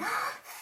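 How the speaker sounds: sharp high gasp of joy; delighted gasp. Pretend. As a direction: gasp at the good news. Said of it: "you'll be able to say".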